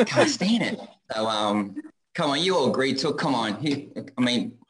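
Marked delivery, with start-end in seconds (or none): 0.0-0.8 high, laughing "really?" in disbelief; mock disbelief. Fans praise his accent, so a high mock-incredulous laugh. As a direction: squeak it out, half laughing at himself. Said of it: "I was like".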